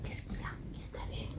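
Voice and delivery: hushed whisper. Real, not acted. Owner whispering to her pet about what it saw. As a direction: breathe it under your voice.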